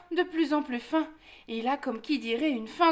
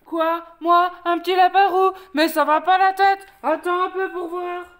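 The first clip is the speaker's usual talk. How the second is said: loud, higher indignant shouting; outraged bear; 5 semitones higher, 8 dB louder than usual. Acted. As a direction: roar back at the insult. Offended bear confronts the wolf. (ours furieux qui crie)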